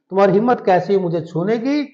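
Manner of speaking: ordinary delivery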